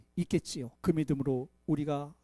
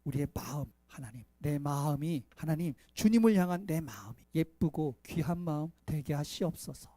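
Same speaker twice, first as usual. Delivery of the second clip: soft, lower prayer voice; 7 semitones lower, 8 dB quieter than usual. Acted. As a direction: pray quietly and earnestly. Closing prayer.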